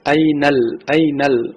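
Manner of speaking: ordinary talk, nothing marked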